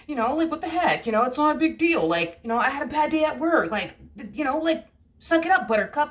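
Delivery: high, mocking flippant voice; dismissive excuse. The partner shrugging off the fight, in a high mocking voice. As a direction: brush it off like it's nothing. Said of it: "that other energy".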